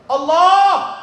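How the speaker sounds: high, loud cry to God; mock tearful cry. Trembling Hasan cries out to God, high tearful cry. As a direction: cry it out in acted anguish. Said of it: "Nangis. Hasan Al-Basri gemeter".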